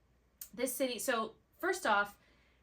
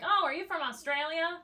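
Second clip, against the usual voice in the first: louder, higher mock-curious tourist; retold eager question; 8 semitones higher, 7 dB louder than usual. Stranger quizzing a New Zealander, mock-curious and higher. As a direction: pipe up as a mistaken stranger. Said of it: "people are like".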